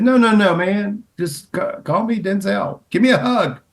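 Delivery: Denzel's hearty welcome. Retold or imitated. Host imitating Denzel greeting her in LA, high and effusive. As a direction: sweep her in like an old friend.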